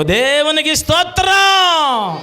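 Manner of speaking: slow ringing praise cry; drawn-out praise. Real, not acted. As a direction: stretch it out for the church. Preacher praise exclamation.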